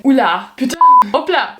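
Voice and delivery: sudden French filler words, loud and high; French exclamations. Retold or imitated. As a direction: blurt the French fillers like a startled Parisian. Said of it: "the amount of times that I am saying".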